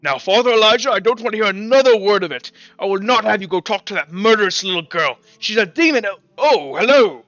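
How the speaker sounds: loud, high, furious priest who suddenly catches himself; angry priest. Acted. Priest refuses to let the father meet the girl, shouting angrily. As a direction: rant at the other priest, then switch to a startled hello. Priest argues, then notices party.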